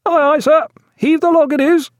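high, loud sailor's acknowledgement; dutiful shouted reply. A narrator's reading. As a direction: echo the captain's order back across the deck. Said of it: "all duty and submission still".

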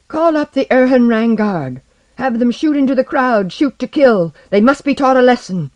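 distinct orders in a loud, high voice. A narrator's reading. Young king ordering guards on the crowd, loud and high. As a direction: command it high and forcefully. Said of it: "spoke rapidly and distinctly in a loud, high voice".

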